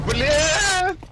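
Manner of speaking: startled yelp. Real, not acted. Sudden upset mid-game.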